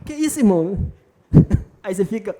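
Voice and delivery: real laugh breaking through the words. Real, not acted. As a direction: laugh out the line, quickly. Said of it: "fica se segurando pra não rir".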